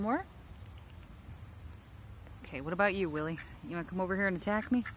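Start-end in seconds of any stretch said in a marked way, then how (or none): none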